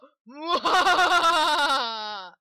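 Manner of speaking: loud hammy villain laugh; evil cackle. Acted. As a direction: cackle like a panto baddie. Villain laugh after intro.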